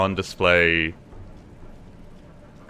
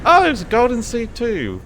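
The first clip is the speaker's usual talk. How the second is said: excited; 4 dB louder than usual. Real, not acted. Finds loot in game.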